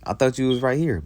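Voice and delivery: high-pitched, bewildered retelling; confused Isaac. Retold or imitated. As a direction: voice his confusion, pitched well up. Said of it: "'cause Isaac confused right now".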